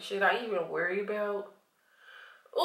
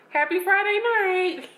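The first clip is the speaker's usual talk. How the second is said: high bright cheer, much louder; excited greeting; 11 semitones higher, 7 dB louder than usual. Real, not acted. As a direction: sing out the greeting happily.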